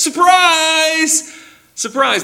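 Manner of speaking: high, loud, bursting cry of surprise; spring the surprise on him with delight; gleeful surprise. Acted. Elder surprising weeping John.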